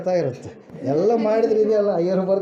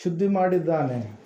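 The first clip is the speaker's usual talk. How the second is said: prayerful address; 2 semitones lower, 3 dB quieter than usual. Real, not acted. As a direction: pray over the people, softly and earnestly. Leads prayer after long pause.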